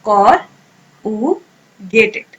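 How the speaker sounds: corrugated split into कॉर, उ, गेटेड with pauses; syllable by syllable. Shown as a demonstration. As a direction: break कॉर - उ - गेटेड apart slowly. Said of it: "मेरे साथ pronounce करें अब".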